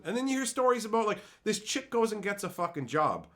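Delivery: normal talking voice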